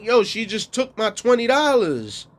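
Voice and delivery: high protesting. Retold or imitated. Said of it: "I'm like".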